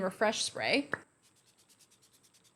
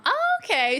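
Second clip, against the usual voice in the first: high, louder, sing-song "oh okay"; mock impressed reaction; 12 semitones higher, 5 dB louder than usual. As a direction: play a mildly won-over onlooker. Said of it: "the look is gonna go from".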